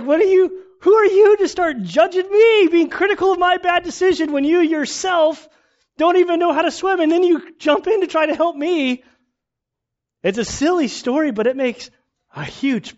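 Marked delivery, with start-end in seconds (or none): 0.0-9.0 high, loud indignant protest; indignant outburst. Retold or imitated. Drowning swimmer snaps back at lifeguard, high and loud indignation. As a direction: hurl it back at the hypocrite lifeguard. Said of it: "the person's like".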